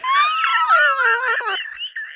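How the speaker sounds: high squealing cackle; a real high-pitched laughing fit; shrieking laugh. Real, not acted. Laughing at her own joke.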